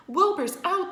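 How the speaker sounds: high, loud excited news; excited horses. A narrator's reading. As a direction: blurt out the gossip. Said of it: "they said".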